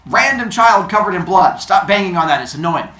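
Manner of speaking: loud, high telling-off; acted exasperated yell; irritated shout. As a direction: bark at a pesky kid to stop. Apollo scolding the noisy child.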